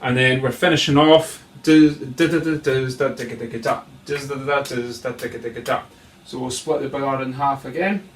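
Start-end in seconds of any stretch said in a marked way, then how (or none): 1.6-5.8 rolling spoken percussion; vocalised drum rhythm. Shown as a demonstration. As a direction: rattle off the drum phrase by mouth. Drum teacher voices the closing phrase.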